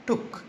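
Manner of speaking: टुक with a quick, short "oo"; short vowel. Shown as a demonstration. Teacher showing children a short double O, टुक said short. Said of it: "अरे भाई short है".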